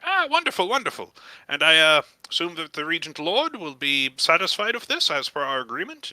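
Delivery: high, effusive gnome; high-pitched, eager gnome merchant; delighted gnome proprietor. Acted. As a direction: gush with relief, then get businesslike. Gnome delighted the deal is verified.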